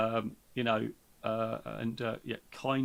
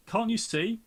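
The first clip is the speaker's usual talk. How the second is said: pitched-up impatient snap; impatient reply; 9 semitones higher, 4 dB louder, slower than usual. A narrator's reading. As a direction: toss it back, fed up with the question. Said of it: "comes the impatient reply".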